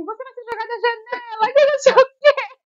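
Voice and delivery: high, rising to loud, mock panic; frightened husband, imitated. Wife imitates husband fearing she'll jump, high and loud. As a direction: blurt it out like a terrified husband. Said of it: "E ele falou assim".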